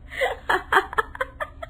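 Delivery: a very high, breathless giggle. Acted. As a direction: giggle breaks out. High laugh escapes again. (ऊँची दबी हंसी)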